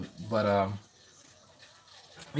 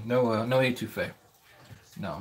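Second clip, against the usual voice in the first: ordinary delivery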